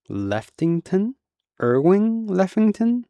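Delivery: can it really be him; dawning recognition. A narrator's reading.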